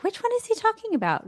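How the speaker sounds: high, quick, puzzled inner voice; mock confused thought. Host mulling a card name, mock puzzled high voice. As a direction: squeak out his own confusion. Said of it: "I'm like".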